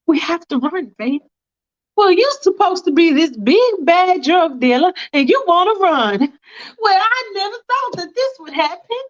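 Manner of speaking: high, taunting scorn; mocking high Faith. Faith mocks the drug dealer for wanting to run, high and taunting. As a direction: taunt him for being scared.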